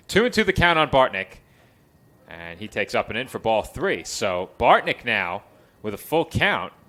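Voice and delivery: live play-by-play. Real, not acted. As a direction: call the game with punch on key words.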